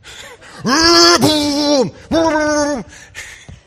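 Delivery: loud, high burst of laughter; big laugh. Real, not acted. Pastor laughing at his grandpa story, a loud high laugh.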